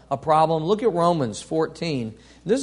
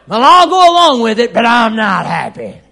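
loud, higher, laughing sarcastic grudging voice; mock grumbler; 4 semitones higher, 10 dB louder than usual. Preacher mocking a member who goes along but gripes, sarcastic. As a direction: mimic someone complying but sulking.

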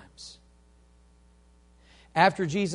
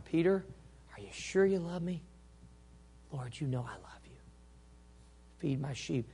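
soft, hushed question and answer; tender exchange; 10 dB quieter than usual. Acted. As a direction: drop to a near whisper for the reply.

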